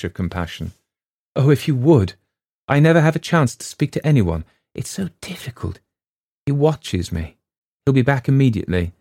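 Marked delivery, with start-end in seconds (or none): 1.4-8.9 higher, louder anxious plea; pleading girl. A narrator's reading. Girl pleading with Wyant, frightened. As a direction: beg for help in a hurry.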